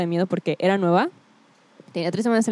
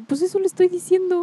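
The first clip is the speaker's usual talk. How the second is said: high-pitched retold protest; exasperated retort; 9 semitones higher than usual. Girl recalls answering her teacher, mock exasperated. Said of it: "Y yo de".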